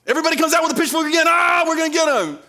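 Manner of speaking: high, loud, rushed battle cry; hammed-up angry crowd charging in; mock mob shout. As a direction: yell like a mob with pitchforks.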